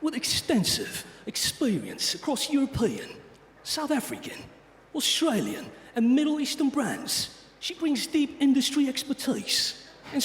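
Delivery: put-on a tough British accent in the style of an action-film star; Jason Statham British accent. Host reading the speaker's bio to the crowd, in a tough British accent. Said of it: "British accent, but as Jason Statham".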